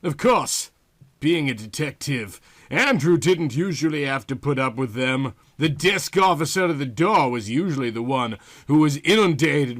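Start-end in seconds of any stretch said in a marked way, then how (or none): none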